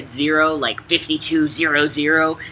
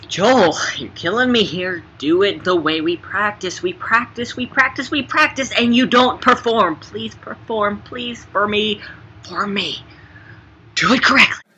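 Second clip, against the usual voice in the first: mock exasperation; 7 semitones higher than usual. Scolding co-star over his read.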